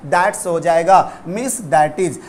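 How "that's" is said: That's said lower as one syllable; that's contraction. Shown as a demonstration. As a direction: clip that is to That's. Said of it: "वो क्या होगा". (That's, नीचे सुर में)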